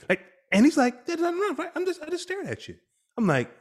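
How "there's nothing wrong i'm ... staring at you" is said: put-on much higher, innocent kid voice; mimicking son. Father mimics son saying nothing's wrong, high innocent voice. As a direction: play the clueless son, fast.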